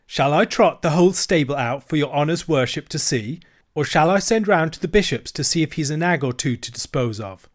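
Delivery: sarcastic dealer. Acted. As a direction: pour on the fake courtesy.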